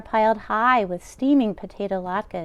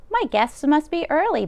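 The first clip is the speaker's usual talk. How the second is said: put-on a lightly higher old grandmother voice; cheerful old baba, a touch higher; old woman's voice; 8 semitones higher, 4 dB louder than usual. Old baba hopefully greeting her guests early. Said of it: "Baba Braina said".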